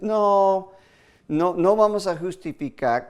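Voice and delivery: emphatic no. Real, not acted. Preacher rejecting the excuse.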